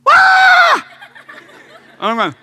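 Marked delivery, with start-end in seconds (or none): 0.1-0.9 a high, loud startled scream; sharp high shriek of surprise; girl's scream. Retold or imitated. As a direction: scream as if the hand burns. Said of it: "she started screaming".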